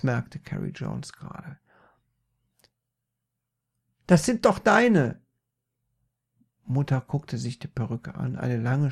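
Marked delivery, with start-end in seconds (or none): 4.1-5.2 high, loud correction; calling back. Acted. Peter corrects mother about wig, high loud call.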